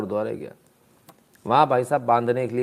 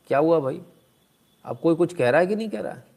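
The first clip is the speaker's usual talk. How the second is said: usual speaking voice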